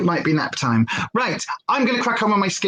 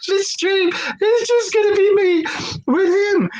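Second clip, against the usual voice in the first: laugh-crying. Real, not acted. Laughing despair at puppy.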